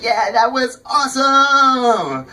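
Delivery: slow, drawn-out mock delight; mock excited fan. Fan thanking him for the free t-shirt, slow and giddy. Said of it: "she was very excited".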